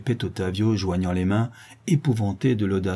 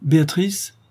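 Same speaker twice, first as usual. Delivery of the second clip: raised-pitch call; husband calling to his wife from outside; 7 semitones higher, 4 dB louder, slower than usual. A narrator's reading. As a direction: call through a closed door. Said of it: "disait en dehors la voix du duc".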